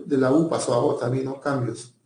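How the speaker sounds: ordinary talk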